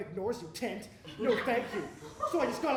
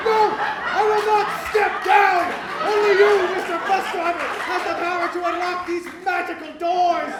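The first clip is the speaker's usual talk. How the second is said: defiant proclamation; 7 semitones higher, 14 dB louder than usual. Acted. Passenger defiantly refusing to step down to the bus driver, loud grand declaration. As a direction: declare it like a hero refusing orders.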